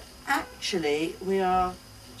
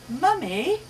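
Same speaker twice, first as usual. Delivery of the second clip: lightly voiced child calling; 9 semitones higher, 6 dB louder than usual. A narrator's reading.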